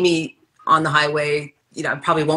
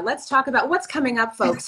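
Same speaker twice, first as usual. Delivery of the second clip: higher, cheery shopping channel host; mock QVC host; 7 semitones higher than usual. Hosting a pretend QVC show with her friend, cheery and higher. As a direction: tease the next segment like a TV host. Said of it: "We could have our own Q V C show".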